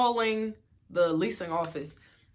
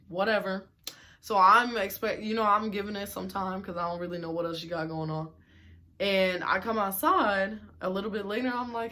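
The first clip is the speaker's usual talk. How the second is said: usual speaking voice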